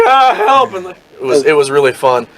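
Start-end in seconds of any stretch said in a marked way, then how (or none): 0.0-0.7 high, yelped mock cry for help; hammed-up frightened yelp; mock panic. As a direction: squeak out a fake panic. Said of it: "And they were like".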